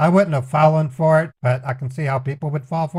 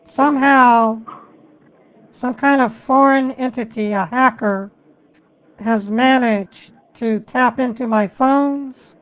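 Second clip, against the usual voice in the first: put-on caller voice; 7 semitones higher than usual. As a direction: play an innocent caller, pitched up. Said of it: "I have to literally pretend".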